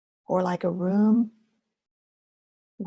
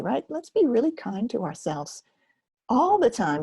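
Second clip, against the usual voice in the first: ordinary delivery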